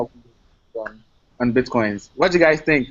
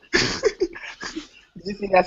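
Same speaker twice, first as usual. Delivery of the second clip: usual speaking voice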